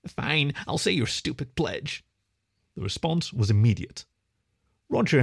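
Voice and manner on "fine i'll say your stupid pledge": grudging. Acted. Boy giving in sulkily.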